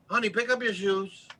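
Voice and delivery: high, sugary honey-voiced mom; mock-gentle mom asking nicely; sweet mother voice. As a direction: coax it like a patient mother. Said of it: "your mother told you".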